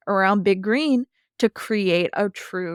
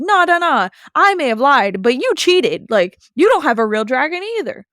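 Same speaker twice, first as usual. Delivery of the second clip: higher, louder indignant retort; accusing villain; 6 semitones higher, 5 dB louder than usual. Retold or imitated. High Roller accusing Big Green of cheating, indignant. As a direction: throw it back at them.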